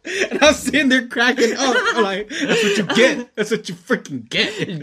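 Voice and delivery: laughing while gloating; cracking up, teasing. Real, not acted. Cracking up telling it.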